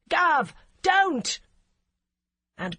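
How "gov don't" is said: high, very loud alarmed shout. A narrator's reading. Constable shouts to stop the DCI, high very loud. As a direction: yell to stop her drawing. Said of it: "Fogarty shouted".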